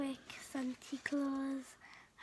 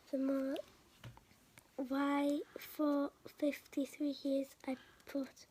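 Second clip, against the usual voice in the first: usual speaking voice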